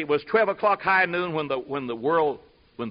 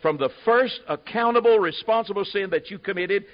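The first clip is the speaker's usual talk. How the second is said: normal talking voice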